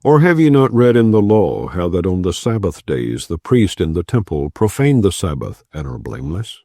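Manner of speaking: ordinary delivery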